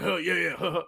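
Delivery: dopey snickering crowd; mock a dopey chuckling crowd; Beavis and Butthead laugh. Laughing along at the heckler, a dopey Beavis snicker. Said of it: "It's like Beavis and Butthead".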